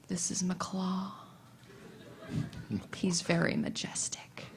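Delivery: low breathy whisper, slow and secretive; imitating a fan's reverent whisper; creepy whisper. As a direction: hush it like sharing something precious. Furry introducing his fursuit.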